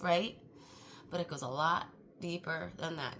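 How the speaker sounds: ordinary talk, nothing marked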